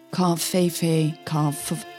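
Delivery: normal talking voice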